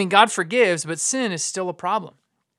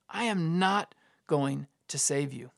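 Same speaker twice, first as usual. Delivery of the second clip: slow, softer, word by word; grave final refusal; 3 semitones lower, 6 dB quieter, slower than usual. Acted. Preacher voicing God's last word to Israel, grave refusal. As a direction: drop it down into a measured verdict.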